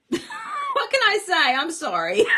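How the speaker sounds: high, slow, giggling sorry; laughing apology. Real, not acted. Laughing apology about the weather.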